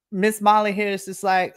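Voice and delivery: ordinary delivery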